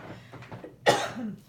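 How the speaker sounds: muffled dry cough. Real, not acted. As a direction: cough from a throat tickle. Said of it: "get rid of this tickle in my throat".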